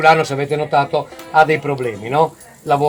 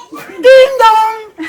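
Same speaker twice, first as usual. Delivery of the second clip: high two-note chime, sung; sung station chime; 4 dB louder than usual. Pretend. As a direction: sing the station bell ding dong. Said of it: "fammi il campanello della stazione".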